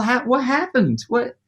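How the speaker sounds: lost and baffled offstage; bewildered. Retold or imitated. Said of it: "walking off with my guitar going".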